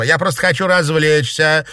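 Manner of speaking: usual speaking voice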